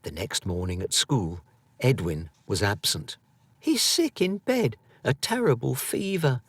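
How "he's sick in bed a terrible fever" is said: lightly voiced little girl, higher; a small girl covering for her brother; girl's voice. A narrator's reading. Little sister lying to the teacher, lightly voiced. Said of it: "said Elle to a teacher".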